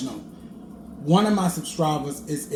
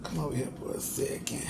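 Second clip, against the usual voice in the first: whisper; 2 semitones lower, 9 dB quieter than usual. Real, not acted. Calls viewers close, softly.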